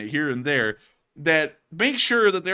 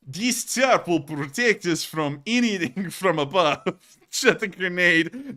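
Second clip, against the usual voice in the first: slow, hammed-up Russian accent; mock Russian accent; 2 semitones higher, slower than usual. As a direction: imitate a Russian soldier's thick accent. Acting a Russian soldier to Matthew.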